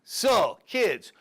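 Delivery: put-on Colombian accent, deliberate delivery; thick mock Colombian accent, punching key words; Carlos, Colombian accent. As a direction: teach kids as a hammed-up Colombian drug dealer. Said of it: "my friend Carlos from Bogota".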